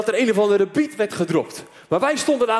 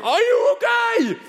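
shouting across the noise; slower than usual. Retold or imitated. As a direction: yell it to someone who can't hear you. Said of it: "heen en weer te schreeuwen".

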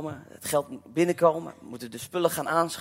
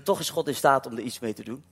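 ordinary delivery

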